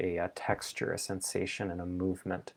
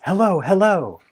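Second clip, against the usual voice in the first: much louder and higher shout; yelled hello; 11 semitones higher, 12 dB louder than usual. Shown as a demonstration. Teacher demonstrating yelling inside your head, a full shout. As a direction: belt it out twice. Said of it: "Try yelling it twice".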